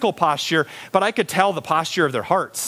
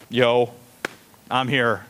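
indifferent; 7 semitones lower, slower than usual. Acted. Acting indifferent teenager at roll call.

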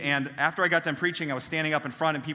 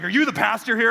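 louder, higher blunt repeat of the question; gruff stranger's question; 6 semitones higher, 7 dB louder than usual. Retold or imitated. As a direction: press the pastor for an answer. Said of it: "He's like".

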